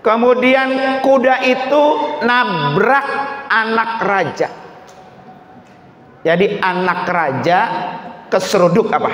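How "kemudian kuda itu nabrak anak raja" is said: high, forceful storytelling from the pulpit; raised preacher's narration; preaching. Acted. Preacher tells mothers of the runaway horse, preaching.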